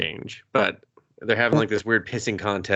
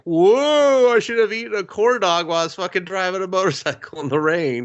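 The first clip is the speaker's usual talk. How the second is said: goofy dazed biker; 9 semitones higher, 3 dB louder than usual. Retold or imitated. Crashing character voiced mid-story.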